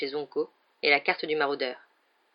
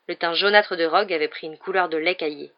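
usual speaking voice

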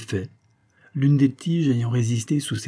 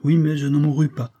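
put-on higher, livelier girl's reply; laughing, understated; 6 semitones higher, 4 dB louder than usual. A narrator's reading. Girl making light of her poisoning to Jacques, mildly laughing. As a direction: toss it off with mild amusement. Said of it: "poursuivait-elle en riant".

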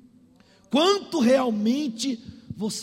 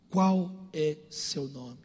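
low, slow, measured voice of God; God's solemn question; 6 semitones lower, much slower than usual. Acted. God asking Jacob his name, low solemn questioning. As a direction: ask it gravely and deep, word by word.

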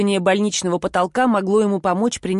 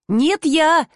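higher-pitched taunting parrot; mocking mimicry; 6 semitones higher, 4 dB louder than usual. A narrator's reading. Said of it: "передразнил он". (издевательски передразнивая)

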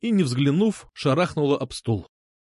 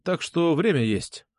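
ordinary delivery

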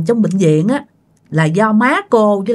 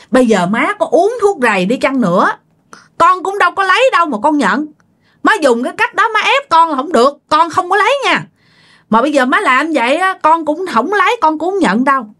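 higher-pitched, insistent retold denial; retold indignant protest; 7 semitones higher than usual. Daughter refusing her mother's pressure to confess, retold indignant protest. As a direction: re-enact standing firm against an accusation.

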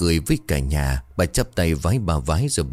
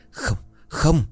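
high, crying scream of no; terrified scream; 9 semitones higher than usual. Acted. Old man screams in terror at the ghost's vision. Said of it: "ông hét lên".

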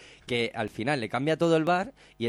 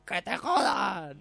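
higher, slow defiant comeback; mock angry retort; 8 semitones higher, much slower than usual. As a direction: spit it back at the chef. Owner answering Chicote's taunt. (respuesta desafiante imitada, lenta)

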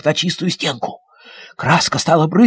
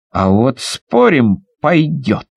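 low, slow, firm reply; boy's steady bet; 6 semitones lower, slower than usual. Acted. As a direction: answer the bet calmly. Boy bets back.

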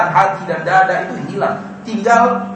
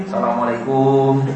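mock beggar's greeting; 5 semitones lower, slower than usual. Greeting from money-seeker.

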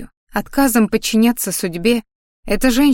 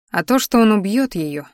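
ordinary delivery

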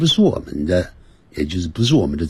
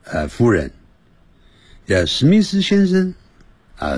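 ordinary talk

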